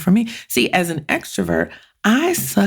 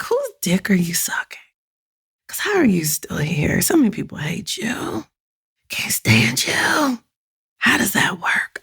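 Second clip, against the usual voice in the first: conspiratorial whisper; whispered mock disbelief; whispered gossip; 3 semitones higher than usual. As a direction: hush it like scandalous gossip. Mocking bewilderment at assholes.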